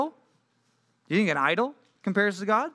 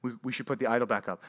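lower, softer, quick sheepish murmur; nervous idol keepers propping up their statue; sheepish worshippers; 5 semitones lower, 4 dB quieter, faster than usual. Retold or imitated. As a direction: mutter it like you've been caught out.

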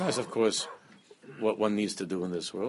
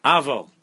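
AVAL punched out much louder; emphatic turnaround; 3 semitones higher, 10 dB louder than usual. Shown as a demonstration. Rabbi showing how the prayer turns around, emphatic. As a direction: hit AVAL hard, falling at the end. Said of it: "then you turn around and you say".

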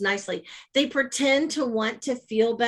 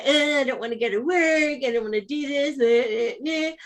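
whiny grumbling, higher pitch; mock sad sack; 4 semitones higher than usual. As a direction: moan like you don't want to get up. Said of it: "all sad sack face and say".

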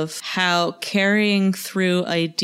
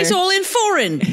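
high-pitched mock British indignation; high, hammed-up complaint about foreign writing; mock British complainer; 12 semitones higher than usual. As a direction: play a baffled Brit, pitched way up. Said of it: "it's like British Britishing, like, being like".

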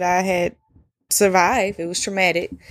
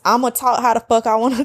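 usual speaking voice

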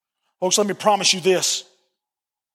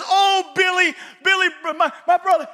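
put-on crying little girl; 10 semitones higher than usual. Little girl running to her parents, high crying wail. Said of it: "and she's crying".

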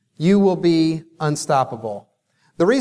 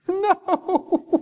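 put-on much higher, tiny kid voice; small child answer; 13 semitones higher, 3 dB quieter than usual. Daughter answering dad about quitting on herself, tiny high no. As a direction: squeak it like a shy little girl.

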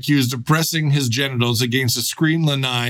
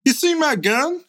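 high mock voice; 12 semitones higher than usual. Acting out the orgy scene.

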